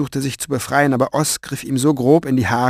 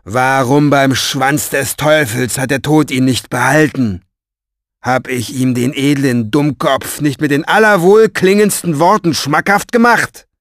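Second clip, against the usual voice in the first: indignant ranting; 3 semitones higher, 7 dB louder than usual. A narrator's reading. Orpheus rails about death not keeping his rival, indignant. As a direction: rail loudly after them, building. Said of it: "schallte Orpheus Stimme ihnen auf der Treppe nach".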